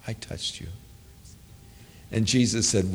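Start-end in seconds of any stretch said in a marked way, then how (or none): 0.1-0.7 fearful confession whispered; trembling whisper. Acted. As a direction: breathe it out, afraid of being caught. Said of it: "fearful and trembling".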